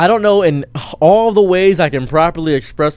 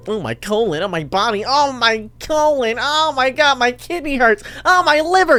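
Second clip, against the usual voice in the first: mock agony; 9 semitones higher than usual. Vlogger exaggerating how much it hurts him, mock agony. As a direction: moan each organ like it's failing, over the top.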